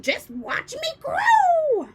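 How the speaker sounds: slow, high, breathy witch whisper; stretched crone whisper. A narrator's reading. Grandma boasting to George, slow eerie whisper. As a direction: draw it out eerily. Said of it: "she shouted".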